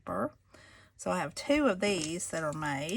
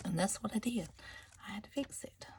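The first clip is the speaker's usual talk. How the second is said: soft sheepish whisper; hushed guilty-giggle whisper; whispered confession; 7 dB quieter, faster than usual. Real, not acted. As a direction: admit it under your breath like a little secret. Confessing the repaired torn page.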